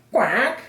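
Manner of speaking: high quack rising like a question; questioning duck quack. A narrator's reading. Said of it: "asked the duck".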